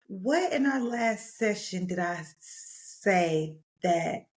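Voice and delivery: slow, measured imitation of a gentle therapist; carefully spaced words, tactfully questioning; professional, very gentle. Therapist gently questioning client about the roster, professional and gentle. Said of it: "she was trying to be, like, professional and, like, very gentle".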